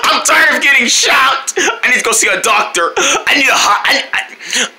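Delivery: high, mock-tearful complaint; hammed-up wailing. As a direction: wail for a doctor in pretend agony. Shocked character wails for help.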